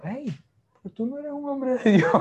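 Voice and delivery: a hint of the wife chiding him; imitating his wife. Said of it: "Y Steph me dijo".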